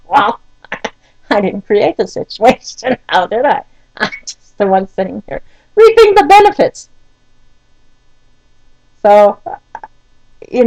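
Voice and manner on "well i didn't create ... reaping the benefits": talking through laughter; laughing exasperation. Real, not acted. Wife laughing helplessly at the late-appointment mess, through laughs. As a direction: laugh at the absurdity while saying it. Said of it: "And so I start to laugh".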